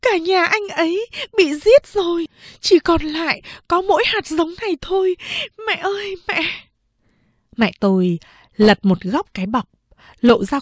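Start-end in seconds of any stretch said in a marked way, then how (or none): 0.0-6.4 high, tearful pleading; begging. Acted. Second sister begging mother to save the boy, tearful. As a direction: beg on your knees.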